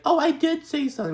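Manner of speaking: very high mock-defensive voice; squeaky put-on insistence; Dorit. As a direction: mimic her protest way up high. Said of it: "And Dorit's like".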